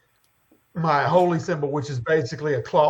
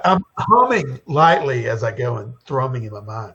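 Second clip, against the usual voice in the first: usual speaking voice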